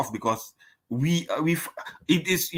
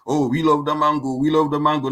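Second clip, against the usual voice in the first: put-on raised, louder caricature of a Jamaican accent; hammed-up Jamaican lilt, pitched up and louder; mocked Jamaican accent; 4 semitones higher, 5 dB louder than usual. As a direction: imitate people stupefying the Jamaican accent. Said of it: "They used to stupefy it".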